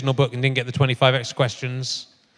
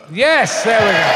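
"there we go" called out louder, higher; calling out; 6 semitones higher, 6 dB louder, slower than usual. Real, not acted. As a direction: call it across the room. Calling out as the mic is fixed.

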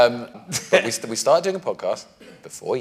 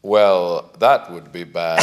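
low, slow cowboy sheriff drawl; 8 semitones lower, much slower than usual. Pretend. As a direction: drawl it out like a lawman. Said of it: "started speaking like a sheriff".

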